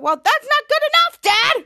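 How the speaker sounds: high, loud angry yell at dad; a teen snapping at her father, loud and high; angry outburst. Retold or imitated. Piper furious at her father.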